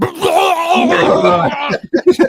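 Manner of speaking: long, loud retching roar; high, hammed-up vomit noise; mock projectile vomiting. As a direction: fake a huge gushing puke. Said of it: "just, like, projectile vomit".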